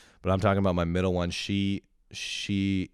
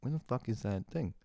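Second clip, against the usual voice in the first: asking the air; 7 semitones higher than usual. Shown as a demonstration. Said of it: "I ask the air".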